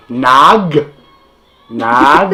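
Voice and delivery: "nag" drawn out on its own; slow teaching "nag" with a clear final g; syllable-by-syllable demo. Shown as a demonstration. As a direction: model "nag" as a separate, stretched syllable. Teacher breaks word into syllables.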